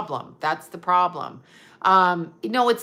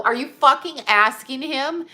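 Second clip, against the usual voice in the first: higher, louder outraged tirade, slowing on "What is wrong with you"; imagined angry rant; 4 semitones higher, 6 dB louder than usual. Retold or imitated. Telling off the pharmacy woman about vaccines, in loud outrage. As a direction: let fly at the stranger, voice up and loud. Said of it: "it was all I could do not to go".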